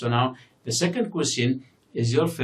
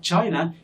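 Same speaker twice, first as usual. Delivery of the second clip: China said higher, strong opening syllable, falling end; stress on first syllable; 6 semitones higher, 3 dB louder than usual. Shown as a demonstration. Teacher pointing learners to the word, first syllable of China stressed. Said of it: "the first syllable here is stressed".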